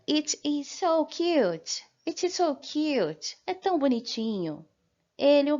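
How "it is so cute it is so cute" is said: slow, higher English model line; slow English demo. Shown as a demonstration. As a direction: say it slowly and clearly, so cute. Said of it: "eu diria".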